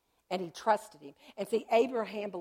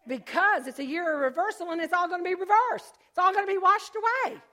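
higher and louder, building like a preacher; rising praise; 10 semitones higher, 10 dB louder than usual. Real, not acted. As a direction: proclaim it with growing fervour. Proclaiming year of reversal.

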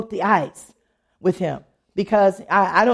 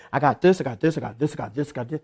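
low, repetitive rattle fading softer; droning list; 7 semitones lower, 4 dB quieter, faster than usual. Acted. Person listing every need when asked, low droning recital. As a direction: reel off troubles in a low voice, fading away. Not saying you gotta say.

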